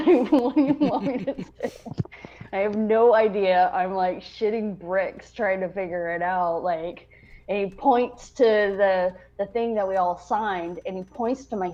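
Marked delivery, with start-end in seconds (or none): none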